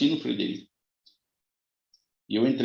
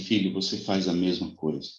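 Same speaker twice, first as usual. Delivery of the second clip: soft, quiet words of a gentle master; 6 semitones lower, 4 dB quieter than usual. Retold or imitated. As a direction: correct him tenderly. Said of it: "Uma voz muito mansa, muito meiga". (voz mansa e meiga)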